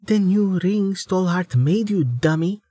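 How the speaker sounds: lightly voiced young woman. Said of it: "Amelia replied silently".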